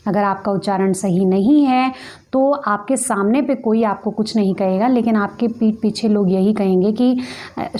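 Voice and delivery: normal talking voice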